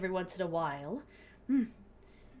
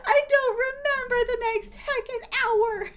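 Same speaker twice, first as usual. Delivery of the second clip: high, loud laughing wail; mock wail; 13 semitones higher, 6 dB louder than usual. Real, not acted. Telling chat about the sake, loud mock wail. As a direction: wail it in comic despair. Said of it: "I don't remember the next heckin' hour".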